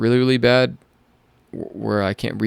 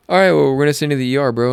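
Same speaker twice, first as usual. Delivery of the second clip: quick, raised, louder imitation of staff; brisk announcement; 6 semitones higher, 5 dB louder, faster than usual. Medical staff abruptly sending patient to the ER, brisk. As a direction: rattle it off in mock-casual haste.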